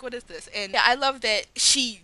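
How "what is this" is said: much softer, deadpan disdain; unimpressed aside. Retold or imitated. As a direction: mutter it with a bored sneer. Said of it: "it's just like".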